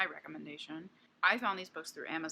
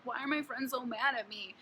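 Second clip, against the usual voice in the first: whiny Morgan; 5 semitones higher than usual. Retold or imitated. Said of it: "and she's like".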